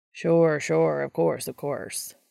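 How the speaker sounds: slow, mocking agreement; a hint of teasing sarcasm; sarcastic. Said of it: "Dean agreed sarcastically".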